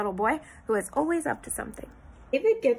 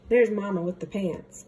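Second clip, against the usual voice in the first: usual speaking voice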